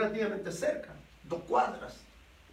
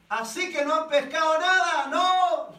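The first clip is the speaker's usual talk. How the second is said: shouting; 7 semitones higher, 5 dB louder than usual. Acted. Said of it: "les grita".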